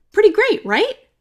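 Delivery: high and loud, delighted; excited. A narrator's reading. Narrator boasting about her plan, excited. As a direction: beam at the listener.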